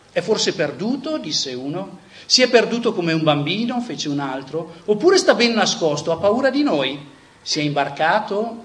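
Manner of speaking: dramatic reading. Acted. Preacher reading Nietzsche's madman parable to his audience, impassioned. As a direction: declaim the madman parable like a prophet, building then sinking to a hush.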